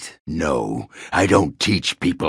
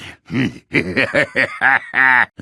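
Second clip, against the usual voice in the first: gleeful booming laugh; 9 semitones higher than usual. Acted. Dwarf laughing with glee after joining the Warden. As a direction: let out a big happy roar of a laugh.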